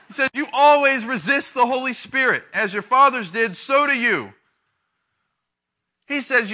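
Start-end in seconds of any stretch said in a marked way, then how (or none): 0.3-4.3 loud, high accusing preaching; angry rebuke. Acted. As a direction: drive it home at them. Stephen's rebuke voiced.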